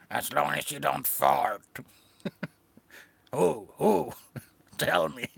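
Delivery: put-on voice of a haggling snail; snail character. Snail bargains with the seller.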